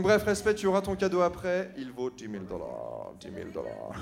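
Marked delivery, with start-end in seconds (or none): 1.8-3.9 deep mock-serious voice. Joke about gift value.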